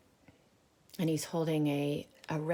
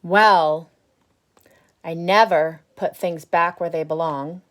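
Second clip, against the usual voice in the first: grumpy bad seed; 3 semitones higher, 11 dB louder than usual. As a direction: boast about being bad, loud. Reading the seed's lines aloud.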